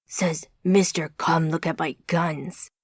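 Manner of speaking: low teasing comeback; deep American man. A narrator's reading. Deep-voiced friend trades jabs, low American voice. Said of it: "said the first voice".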